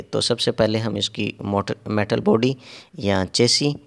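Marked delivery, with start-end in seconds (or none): none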